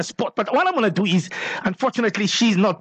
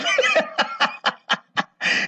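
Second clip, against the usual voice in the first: high squealing laugh. Real, not acted. Radio host laughing as the caller says the girl cries.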